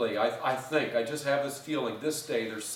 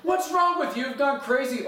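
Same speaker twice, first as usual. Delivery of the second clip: baffled high shout; 11 semitones higher, 5 dB louder than usual. Retold or imitated. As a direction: call out high and loud after him. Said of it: "And he said".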